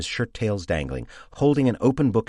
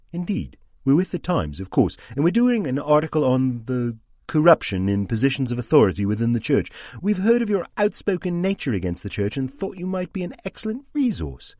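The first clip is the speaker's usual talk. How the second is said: Wiggins; 6 semitones higher than usual. A narrator's reading. Wiggins voiced by narrator.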